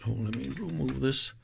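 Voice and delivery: normal talking voice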